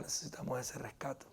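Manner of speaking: hushed, breathy confession to the church; soft unvoiced whisper; whispered aside. Real, not acted. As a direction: whisper it like a personal admission. Pastor drops to a hush.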